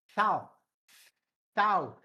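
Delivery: Sal with the S hissed out longer; stressed S. Shown as a demonstration. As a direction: lean on the S of Sal, tongue out. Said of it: "Estressa esse som do S".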